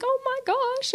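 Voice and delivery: very high, mock wounded little voice; sad old ladies. Old ladies turned away from the priest, mock sad. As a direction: imitate let-down old ladies, high-pitched. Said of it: "they were so sad".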